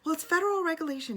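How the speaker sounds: high, loud, fast mock yell; imitated yelling. Conductor still yelling at passenger, high imitated yell. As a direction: imitate a shrieking official quoting rules. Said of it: "still yelling at me".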